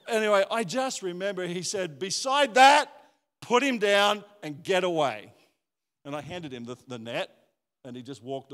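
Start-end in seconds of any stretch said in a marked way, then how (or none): 2.0-5.3 very high, loud order that eases off; a dad's fierce protective command; commanding shout. Retold or imitated. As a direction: yell it to drive the man away. Dad ordering stranger off.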